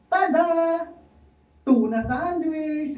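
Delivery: high, slow showman's reveal; proud reveal. Acted. Juan grandly reveals his sandwich to Pedro, high and slow. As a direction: unveil it grandly.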